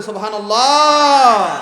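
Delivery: long, loud, slow shouted praise; drawn-out shout. Shown as a demonstration. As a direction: call it out with full force, drawn long. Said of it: "আরেকটু আসতে বলি".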